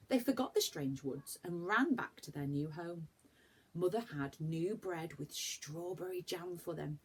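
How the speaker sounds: usual speaking voice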